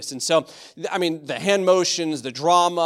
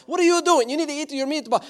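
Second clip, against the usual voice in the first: hammed-up Italian scolding, pitched way up; 10 semitones higher than usual. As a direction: play an over-dramatic Italian relative at dinner. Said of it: "There's Italian accents all over the place".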